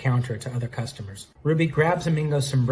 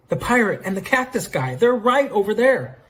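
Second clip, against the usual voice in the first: much higher, rising urgency; excited pointing-out; 9 semitones higher, 3 dB louder than usual. Acted. Jill telling Leon they're over there, high excited urgency. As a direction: build to a high, loud exclamation.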